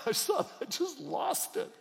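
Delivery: choked-up laugh, rising high; laughing tearfully. Real, not acted. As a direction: laugh through tears. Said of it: "I'm losing it again".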